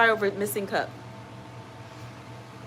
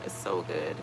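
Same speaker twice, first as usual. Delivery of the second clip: normal talking voice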